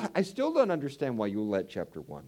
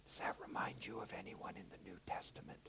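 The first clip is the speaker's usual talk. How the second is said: hushed, secretive whisper; whispered hint; 4 semitones lower, 18 dB quieter than usual. Real, not acted. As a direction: lean in and whisper the question.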